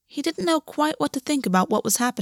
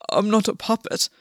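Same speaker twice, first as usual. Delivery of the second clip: hoarse. Acted. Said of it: "Gwen said hoarsely".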